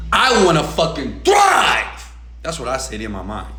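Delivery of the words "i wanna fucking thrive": slow build to a shouted last word; defiant declaration. Acted. Climax of defiant answer to parents.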